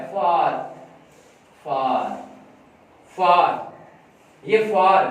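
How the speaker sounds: put-on slow clear far for the child; model word far. Shown as a demonstration. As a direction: say far with an open long a. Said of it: "यहाँ पर बच्चे को बताएं".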